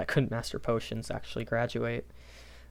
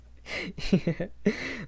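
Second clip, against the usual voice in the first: giggle; 8 semitones higher than usual. Real, not acted. Laughs mid-sentence.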